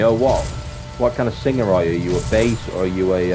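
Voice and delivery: normal talking voice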